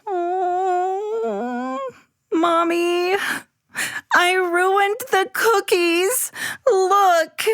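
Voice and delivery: put-on high, crying child voice, slow; tearful child. Abby confessing to her mom, tearful. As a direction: sob it out to mom.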